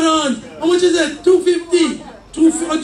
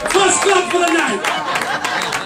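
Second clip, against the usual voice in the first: slow drawn-out call; much slower than usual. Real, not acted. Preacher to congregation, asking for applause, slow and drawn out. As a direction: drag out each word to the crowd, slowly. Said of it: "Give me a clap".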